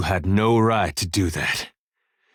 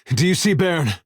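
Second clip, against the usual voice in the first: anxious, higher-pitched question; urgent searching; 8 semitones higher than usual. Acted. Companion searching the dead for Baron, anxious and urgent. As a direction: ask urgently, voice raised in pitch.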